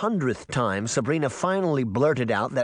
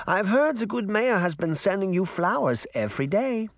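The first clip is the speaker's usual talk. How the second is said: put-on high-pitched granny with a light German accent; 9 semitones higher than usual. Granny probing about the mayor's flowers, light German accent. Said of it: "in her light German accent".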